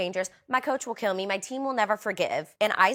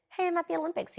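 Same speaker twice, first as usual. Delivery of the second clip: high, light little quoted voice; mock chirpy debut; 10 semitones higher than usual. Gymnast voicing an Olympic debut after waiting, mock chirpy. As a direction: pitch it up, playfully announcing yourself. Mimics an athlete's big debut moment.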